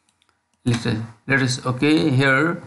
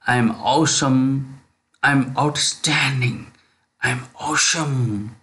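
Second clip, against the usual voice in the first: stretched-out, deliberate repeat-after-me delivery; slow affirmation drill; slow model affirmations; slower than usual. Shown as a demonstration. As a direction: draw each phrase out slowly for students. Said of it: "please say".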